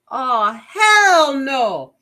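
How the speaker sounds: loud, high hell no; real indignant refusal; outraged. Real, not acted. Rejecting election result.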